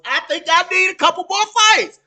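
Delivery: mock quote. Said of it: "And Manone's answer was".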